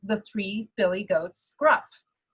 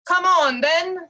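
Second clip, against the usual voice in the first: much louder, slow, bold challenge; big goat's challenge; 8 semitones higher, 7 dB louder than usual. Acted. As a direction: dare the troll to come at him. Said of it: "said the third billy goat Gruff".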